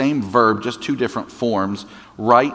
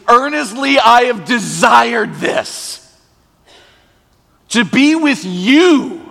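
loud, high, ardent cry of longing; passionate longing; 10 semitones higher, 6 dB louder than usual. Acted. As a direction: pour out Jesus' eager love for his friends. Preacher voices Jesus' longing.

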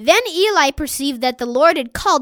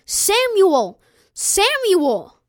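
higher, repeated narrated call; 5 semitones higher, 3 dB louder, much slower than usual. A narrator's reading. Voice calls Samuel a fourth time, crying out. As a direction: call the name out twice, falling each time. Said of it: "The voice cried".

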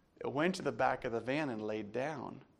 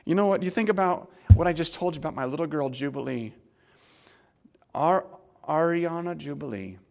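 loud, high, driving sermon voice; preacher building up to his congregation, loud; preaching at full force; 4 semitones higher, 13 dB louder, faster than usual. Acted. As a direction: preach it hard to the crowd.

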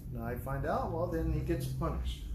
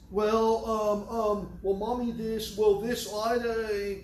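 put-on stammering child; 4 semitones higher, slower than usual. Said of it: "And then he starts".

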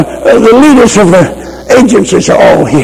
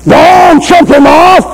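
preaching shout; 8 semitones higher, slower than usual. Real, not acted. Preacher exhorting the congregation.

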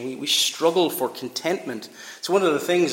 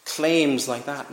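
ordinary talk, nothing marked

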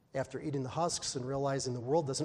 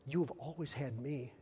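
softer, slow gentle reassurance; slow it down, lovingly; father quietly reassuring his elder son; tender father; 2 semitones lower, 7 dB quieter, slower than usual. Acted.

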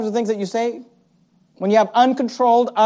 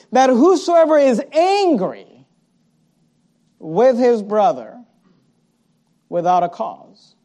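slow emphatic reading; slower than usual. Shown as a demonstration. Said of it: "slow emphatic scripture".

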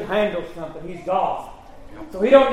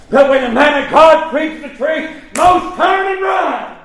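high, loud pulpit cry; forceful preaching; 6 semitones higher, 5 dB louder than usual. Real, not acted. Preacher lamenting how people flee the truth, forceful preaching. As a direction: cry it out to the congregation.

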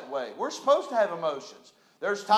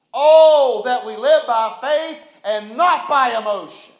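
preaching shout; 9 dB louder than usual. Real, not acted. Pastor preaching at full force.

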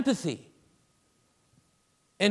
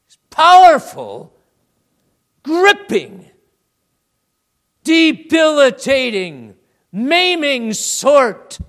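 much louder and higher, slowing to a crawl; booming build; 7 semitones higher, 12 dB louder, slower than usual. Real, not acted. Preacher thunders at the sin burdening the congregation, slowing down. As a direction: thunder each word of the list.

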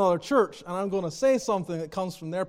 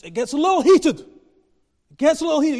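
louder, higher, slowed repeat of heated; emphatic repeat; 7 semitones higher, 8 dB louder than usual. Shown as a demonstration. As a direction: lean hard on it gets a little heated. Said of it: "preacher repeats for emphasis".

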